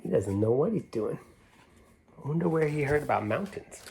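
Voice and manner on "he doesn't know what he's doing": ordinary delivery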